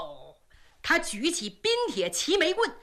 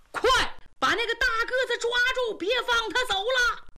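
higher, fast shouted order; soldiers shouting; 9 semitones higher than usual. Acted. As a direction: patrol yelling to seize the runner. 官兵喊抓人. (官兵高声喊叫)

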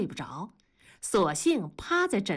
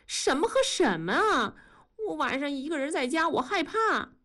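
put-on higher, fast childlike plea; pleading scared; 7 semitones higher than usual. A narrator's reading. Wife begs husband not to leave, scared pleading. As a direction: whimper that you're afraid.

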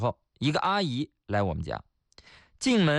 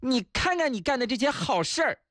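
much higher-pitched, sneering telling-off; sarcastic scolding wife; 10 semitones higher than usual. Acted. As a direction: scold him with sarcastic praise. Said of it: "我老婆果然接受了我的建议". (老婆阴阳怪气地骂)

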